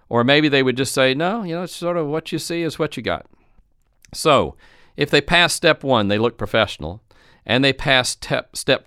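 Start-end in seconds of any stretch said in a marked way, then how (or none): 1.1-3.3 higher, softer, indifferent store employee; mock a clerk who can't muster excitement; unenthusiastic. Employee with nothing new to show a shopper, unenthusiastic. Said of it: "can they emote".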